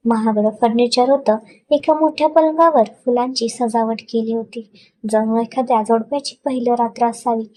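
usual speaking voice

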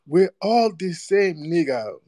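high, slow put-on protest; mock plea. Host imitating those who say we're all the same, mocking high drawl.

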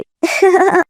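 high-pitched taunting laugh; mocking giggle. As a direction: laugh scornfully at the poor children. Girl mocks poor siblings. (ঠাট্টার তীক্ষ্ণ হাসি)

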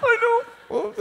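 put-on high, small child voice; sheepish child. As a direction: admit it meekly. Boy answers sheepishly.

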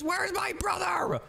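high, slow shouted call for his brother. Pretend. Boy hunting for his missing brother, calling out high and slow. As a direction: call out across the house, drawn out.

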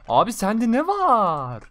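loud gleeful drawn-out question; excited. Real, not acted. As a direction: drag it out with glee. Excited looting of downed enemy.

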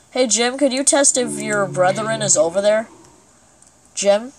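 usual speaking voice

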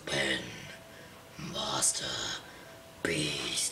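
unvoiced hushed whisper. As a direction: breathe the name in a whisper. Whispered sign-off name.

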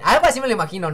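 ordinary delivery

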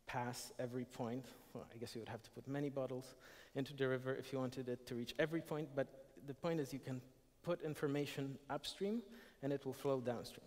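normal talking voice

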